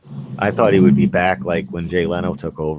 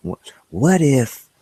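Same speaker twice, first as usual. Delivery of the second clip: slow, higher, dramatic science narrator; Michio Kaku impression; 6 semitones higher, slower than usual. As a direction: wonder aloud like a TV physicist. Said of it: "that's more like Michio Kaku".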